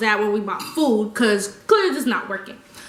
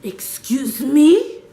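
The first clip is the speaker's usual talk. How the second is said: menacing whisper; 2 semitones higher, 3 dB louder, much slower than usual. Retold or imitated. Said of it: "And the bouncer goes".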